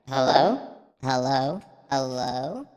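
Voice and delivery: robotic mic filter. Real, not acted. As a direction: test the mic through a robot effect. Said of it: "This is like the robot thing".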